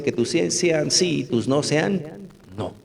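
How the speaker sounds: ordinary talk, nothing marked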